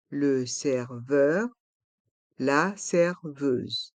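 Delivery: "Le serveur, la serveuse" syllable by syllable, slowly; slow model pronunciation. Shown as a demonstration. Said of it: "il y a le son E".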